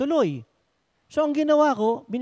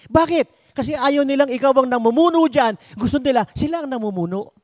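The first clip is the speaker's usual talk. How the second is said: preaching; 3 semitones higher, 5 dB louder than usual. Acted. Preaching at full force.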